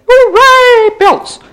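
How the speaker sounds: high, loud, drawn-out hooray; playful cheer. Pretend. Thanking the universe at the mailbox, playful loud cheer. Mock cheer for bills arriving.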